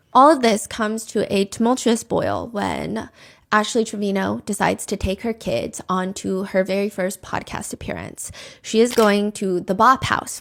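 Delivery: ordinary talk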